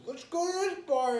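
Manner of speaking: loud, high-pitched party cheer; rally everyone like an eager drinker; imitated drunken excitement. Non-drinker mimicking spontaneous drinkers heading out, loud mock excitement. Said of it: "you'll be drinking, you'll be like".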